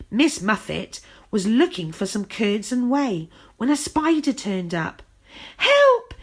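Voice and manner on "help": high, loud panicked scream for help; storybook scream much higher and louder. A narrator's reading. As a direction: shriek it in terror. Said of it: "she screamed".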